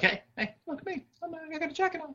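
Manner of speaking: high squeaky show-off boast; mocking show-off voice. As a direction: prance and boast in a silly high voice. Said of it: "Reed can go blow up".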